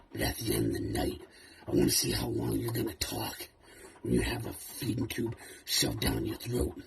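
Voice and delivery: a cold, hushed threat to Dewey; quiet menace. Acted. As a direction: pull it back and threaten low and quiet. Threats turn quiet after outburst.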